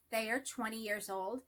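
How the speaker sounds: ordinary talk, nothing marked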